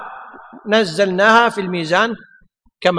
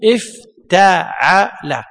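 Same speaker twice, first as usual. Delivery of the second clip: slow model word; 4 semitones lower, much slower than usual. Shown as a demonstration. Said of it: "أصله".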